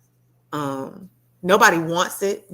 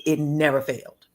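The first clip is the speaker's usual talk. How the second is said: ordinary talk, nothing marked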